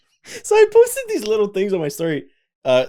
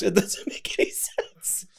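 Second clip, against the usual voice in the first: whispered aside; 8 dB quieter, slower than usual. Real, not acted. Embarrassed aside to himself.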